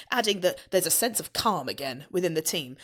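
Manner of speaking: usual speaking voice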